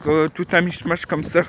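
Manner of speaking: ordinary delivery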